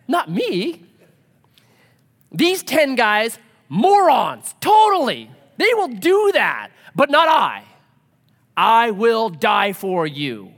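high, loud, overblown self-assurance; boastful Peter. Acted. Peter swearing to Jesus he won't flee, boastful. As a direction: proclaim your loyalty like a grandstanding hero. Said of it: "what does Peter say".

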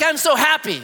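high-pitched, playful show of glee; mock happy. Preacher joking about his own happiness, mock happy. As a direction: play up being thrilled. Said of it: "when I get happy".

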